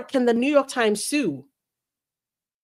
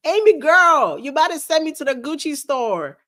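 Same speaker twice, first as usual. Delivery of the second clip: excited thanks; 6 semitones higher, 5 dB louder than usual. Real, not acted. Thanking a big super chat.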